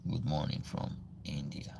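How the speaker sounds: whispered under the breath while reading a viewer comment; muttered comment reading. Real, not acted. Streamer reading a viewer's chat greeting to himself, whispered. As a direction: mutter it to yourself, barely voiced.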